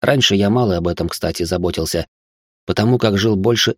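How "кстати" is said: usual speaking voice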